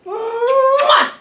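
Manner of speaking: big smacking kiss sound; high loud mwah. Acted. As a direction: plant a loud smooch.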